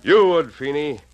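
loud, high, cutting him off; forceful accusation. Acted. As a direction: hammer the accusation home. Insists Feeney would pay gunman.

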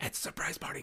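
hushed, urgent stage whisper; shushing whisper. Acted. Cronies shushing each other behind the curtain, urgent whisper. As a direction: whisper it like a hidden party guest. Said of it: "shushing each other and going".

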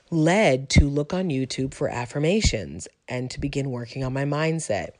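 normal talking voice

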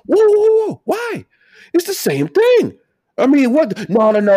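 high, loud exclaimed whoa; incredulous outburst at the double standard; shouting. Real, not acted. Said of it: "I don't know why I'm shouting".

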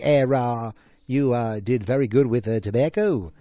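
hammed-up senator on the phone, halting and loud; bad Robert F. Kennedy impression. Prank caller posing as the senator, in a bad put-on Boston voice. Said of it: "the worst Robert F. Kennedy impression ever".

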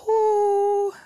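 high, loud drawn-out "whoo"; overwhelmed whoo. Real, not acted. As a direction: let the emotion burst out in one long whoop. Overwhelmed with gratitude.

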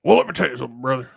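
gruff wrestling promo voice; Hulk Hogan impression. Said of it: "Hulk Hogan impression".

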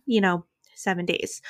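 normal talking voice

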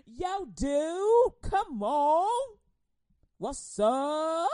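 loud, high street-buddy Jesus; loud casual street Jesus. Retold or imitated. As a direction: call out to him like a homie. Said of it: "Jesus like".

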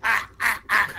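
high choking ah sounds; a hammed-up monster gag, pitched high; creature gagging. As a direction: gag like a beast with its tongue out too far. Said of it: "you hear the creature gag".

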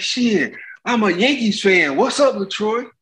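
high slow put-on Jordan voice; mock Jordan. Jordan teasing the pitcher, high and slow. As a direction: pitch it up, drawn out, amused.

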